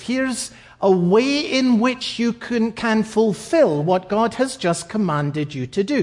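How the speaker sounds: ordinary talk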